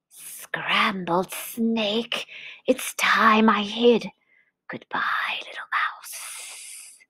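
slow whispery snake with long s sounds; hushed snake voice. Acted. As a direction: whisper a hasty snake farewell, fading away. Frightened Snake flees.

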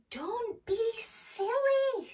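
high, slow, drawn-out chiding; mock scolding. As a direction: stretch it out to tease a silly friend. Nibbles scolds the frightened mouse.

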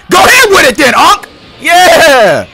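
loud, high taunting; hype shout. Real, not acted. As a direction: yell it at the screen. Taunting enemy in fight.

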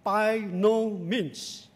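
slow, heavy stress on "no" in "by no means"; emphatic. Acted. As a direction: lean hard on "no", drawing out "by no means". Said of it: "with an emphatic".

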